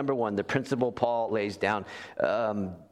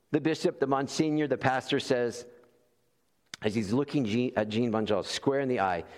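ordinary talk

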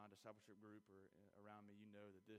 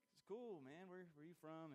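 laid-back repairman; 6 semitones higher than usual. Retold or imitated. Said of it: "He's like".

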